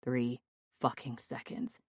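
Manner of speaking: usual speaking voice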